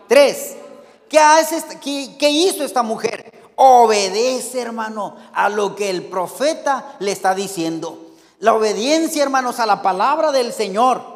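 loud, driving preacher voice on every point; preacher to congregation, building to loud fervent exhortation; full-force preaching. Real, not acted.

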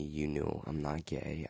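usual speaking voice

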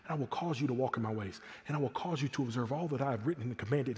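quiet, steady, matter-of-fact promise; soft God voice. Retold or imitated. Preacher voicing God answering Ezekiel, soft and steady. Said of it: "And God says".

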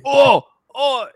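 put-on high, loud Australian accent shout. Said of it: "You guys like it?".

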